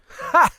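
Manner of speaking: single loud high laugh bark; loud amused ha; sharp laugh. Acted. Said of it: "He laughs".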